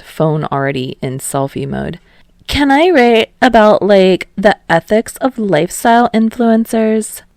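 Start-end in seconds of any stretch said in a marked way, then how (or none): 2.5-7.2 put-on higher, louder girl's question; bright eager student pitching her idea. Selfie-ready girl asking the professor, higher and louder. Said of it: "some girl raised her hand".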